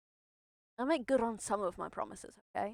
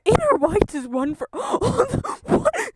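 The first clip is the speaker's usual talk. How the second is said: loud, high reading broken by laughter; cracking up; 12 semitones higher, 12 dB louder than usual. Real, not acted. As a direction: try to read aloud, then squeal in disbelief. Cracking up reading garbled captions.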